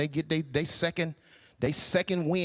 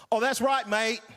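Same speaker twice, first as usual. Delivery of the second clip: mock British accent; 2 semitones higher than usual. Actor faking British at audition.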